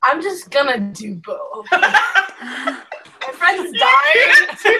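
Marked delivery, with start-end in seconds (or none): 1.7-4.7 loud, drawn-out playful wail; hammed-up desperate shout; mock desperate outcry. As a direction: cry out in exaggerated protest, half laughing. Player protests in mock desperation, laughing.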